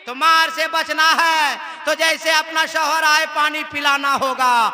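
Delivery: loud, insistent sermon cadence; rhythmic exhortation from the pulpit; full-force preaching. Acted. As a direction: drive each duty home to the crowd.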